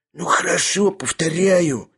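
high, hoarse, cowed reply. A narrator's reading. Sharikov gives in while held, hoarse. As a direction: squeeze it out hoarsely. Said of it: "сиплым голосом ответил".

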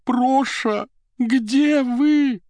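very high, singsong call; musical, tender, nasal. A narrator's reading. Secretary calls for her missing Проша, musical and tearful. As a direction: call out musically, nose stuffed from crying. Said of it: "музыкальным, нежным голосом, немного гнусавым после плача".

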